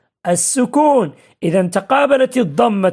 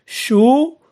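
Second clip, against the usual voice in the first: syllable by syllable. Shown as a demonstration. Teacher sounding out an example word for pupils, شؤون syllable by syllable.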